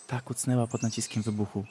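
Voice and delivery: ordinary delivery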